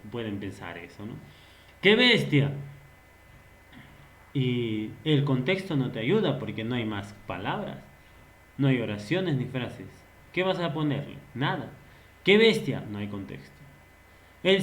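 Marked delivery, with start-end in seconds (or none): 1.8-2.5 loud exclamation; exclaimed. Shown as a demonstration. Teacher acting out a classmate, loud exclamation. 12.2-12.8 loud exclamation; exclaimed. Shown as a demonstration. Teacher acting out a classmate, loud exclamation.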